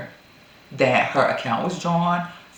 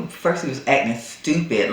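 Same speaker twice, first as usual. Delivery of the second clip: usual speaking voice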